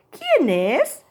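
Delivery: mild storybook shout; mouse calling out. Acted. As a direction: call out as a startled little mouse, lightly hammed-up. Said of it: "grita Ratón".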